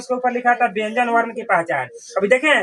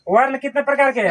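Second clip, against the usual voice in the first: ordinary talk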